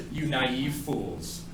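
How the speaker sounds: evil cackle, then a slow, drawn-out taunt; mock villain. Teacher mock-gloating over his students, slow villain taunt.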